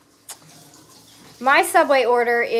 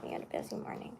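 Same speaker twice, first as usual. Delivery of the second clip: whispering; 22 dB quieter, faster than usual. Real, not acted. Whispered aside.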